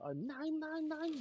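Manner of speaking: high mock yelled callout; hammed-up high-pitched callout scream. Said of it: "just screaming that".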